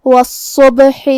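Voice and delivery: والصبح with a doubled, heavy ص and a bounced ب, said slowly; full-mouthed ص; heavy sad. Shown as a demonstration. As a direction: puff the cheeks for the heavy ص in والصبح. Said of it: "بھاری آواز میں اور منہ پھلا کے ادا".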